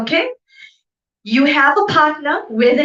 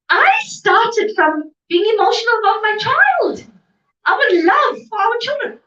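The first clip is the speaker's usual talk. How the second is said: raised, impassioned voice; passionate shout; 10 semitones higher than usual. Real, not acted. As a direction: cry it out with fervour. Impassioned seminar speech.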